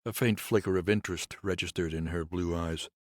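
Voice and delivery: ordinary delivery, nothing marked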